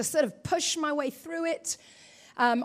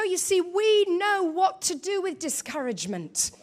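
ordinary delivery

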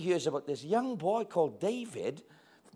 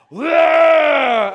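huge high roaring shout; giant's roar; 11 semitones higher, 20 dB louder than usual. Acted. Goliath roaring at the frightened army, loud. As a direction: blast a monster roar.

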